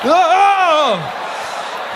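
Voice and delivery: high yelp of surprise; a sudden high Oh; surprised cry. Acted. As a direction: jump at it. Reacting to wave.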